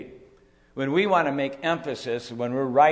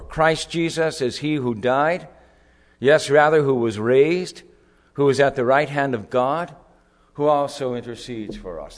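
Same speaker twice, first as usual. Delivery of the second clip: preaching proclamation; 6 dB louder than usual. Acted. Proclaims verse thirty-four.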